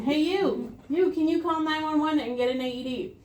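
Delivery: single someone out and direct them; calling out. Shown as a demonstration. Rescuer tells a bystander to phone for help, calling out.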